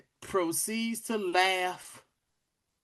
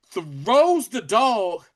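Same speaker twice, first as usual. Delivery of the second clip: ordinary talk, nothing marked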